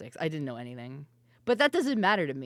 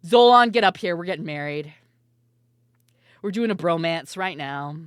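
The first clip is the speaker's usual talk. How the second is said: louder, grudging mock groom; resigned Ko voice; 10 dB louder than usual. Streamer voicing Ko accepting a double wedding, grudging and loud. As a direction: give in loudly with a groan. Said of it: "Be like".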